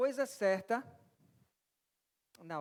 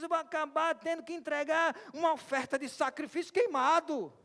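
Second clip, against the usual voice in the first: rising, louder, exasperated complaint; Martha complaining; 8 semitones higher, 6 dB louder than usual. Retold or imitated. Preacher voicing Martha fed up in the kitchen, loud exasperated whine. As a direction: voice the overworked hostess grumbling, pitch climbing. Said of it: "Talvez ela tivesse pensando algo do tipo assim".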